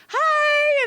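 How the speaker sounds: very high, sung-out hi; eager greeting. Retold or imitated. Retelling waving back at a kid, over-eager high greeting. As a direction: imitate an over-eager hello.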